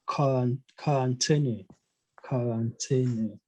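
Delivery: quarantine said "ka-ran-teh-ne"; German quarantine, opening on K, ending in "-e"; German pronunciation. Shown as a demonstration. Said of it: "it's pronounced as a K".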